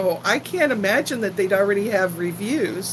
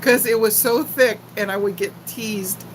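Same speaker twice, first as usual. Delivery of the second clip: ordinary talk, nothing marked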